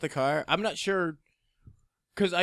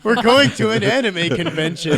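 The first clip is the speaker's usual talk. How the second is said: laughing; 6 semitones higher, 10 dB louder than usual. Real, not acted. Laughing at his own nerd line.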